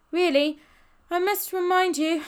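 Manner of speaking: high, loud, out of breath; puffing. A narrator's reading. Ben answering a taunt uphill, puffing. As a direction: push the words out between puffs. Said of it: "puffed Ben".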